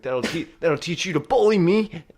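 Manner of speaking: high, loud comic outburst; hammed-up vengeful cry; vengeful mock yell. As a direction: shout it like triumphant payback. Acted vengeful yell while pelting kids.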